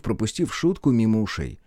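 plain reading voice

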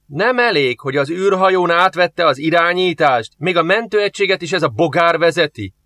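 a soldier seething over losing control; fuming. A narrator's reading. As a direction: fume at the droid pilot. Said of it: "dühögött Joff".

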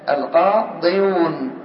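القاضيون drawn out slowly so the y and w clash is heard; slow heavy form. Shown as a demonstration. Teacher showing students the heavy original form, slow and deliberate. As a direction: stretch القاضيون out syllable by syllable. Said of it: "فيها ثقل أو ليس فيها ثقل؟".

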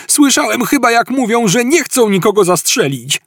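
high-pitched, agitated complaint; alarmed protest. A narrator's reading. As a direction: protest in a squeal while under fire. Artur protesting under fire. (piskliwy, wzburzony protest)

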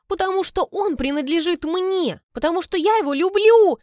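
high, loud irritated outburst; irritated exclamation. Acted. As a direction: snap it out, rising to a cry. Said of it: "восклицает она раздражённо".

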